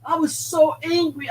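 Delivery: normal talking voice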